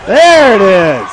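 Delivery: big drawn-out there it is; excited call. Real, not acted. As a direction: sell the made three. Three-pointer goes in.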